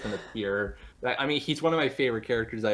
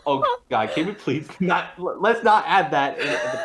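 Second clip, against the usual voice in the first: loud, higher plea through laughter; laughing plea; 7 semitones higher, 8 dB louder than usual. Real, not acted. Guest begging host to keep it out of the podcast, laughing plea. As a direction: beg through embarrassed laughs. Embarrassed plea not to air that.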